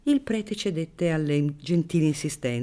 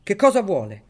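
loud demand; 6 semitones higher, 7 dB louder than usual. Acted. Baron demanding, annoyed.

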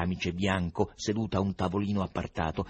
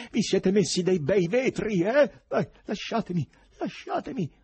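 grido acuto di chi si dibatte; gridato; 14 semitones higher, 6 dB louder than usual. A narrator's reading. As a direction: protesta gridando, trattenuto a forza. (gridato, furibondo)

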